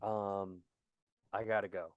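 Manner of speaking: very low, hushed regretful announcement; mock-solemn. Host telling Gracie he has to go, mock-solemn and hushed. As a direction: break it gravely, barely voiced. Stages a grave exit before guest.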